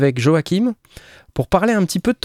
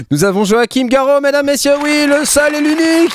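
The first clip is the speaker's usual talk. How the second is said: announcer voice; 11 semitones higher than usual. Acted. Grand introduction of the guest.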